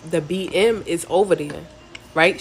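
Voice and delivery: plain reading voice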